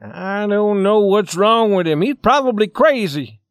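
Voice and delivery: loud, high, exasperated explanation; angry. Acted. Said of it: "gathering them together angrily".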